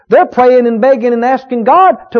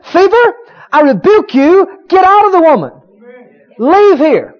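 commanding rebuke; 7 semitones higher, 3 dB louder than usual. Retold or imitated. Preacher acting Jesus rebuking the fever, commanding. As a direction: order the fever out with authority. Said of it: "He must have said something like this".

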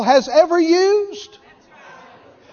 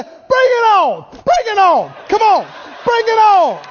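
defiant bring it on; 6 semitones higher than usual. Retold or imitated. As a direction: taunt the enemy from behind the shield. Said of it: "people around say".

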